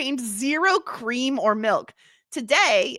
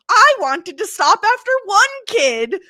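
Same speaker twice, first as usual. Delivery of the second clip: outraged cry; 9 semitones higher, 8 dB louder than usual. Retold or imitated. Quoting the mother, outraged.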